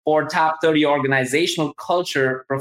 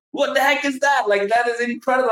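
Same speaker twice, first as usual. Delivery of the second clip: much higher, genuinely astonished; amazed; 8 semitones higher than usual. Real, not acted. Host marvelling at guest's LinkedIn followers, astonished. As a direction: let the disbelief lift the voice high.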